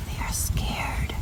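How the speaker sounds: hushed, slow whisper. Real, not acted. Whispering beside the scared hens. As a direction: whisper so the hens aren't startled.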